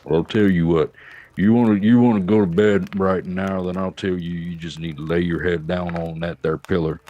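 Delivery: a light low Texan drawl; a hint of an unhurried Texan cowboy; Sam Elliott drawl. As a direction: lull the listener to sleep like a laid-back old cowboy. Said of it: "be like, "Sam Elliott".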